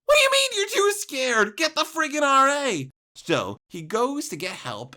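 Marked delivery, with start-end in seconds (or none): 0.1-2.9 loud, high outraged shout, acted out; exasperated yelling. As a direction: yell in disbelief at a useless helper. Retold outraged reply to roommate.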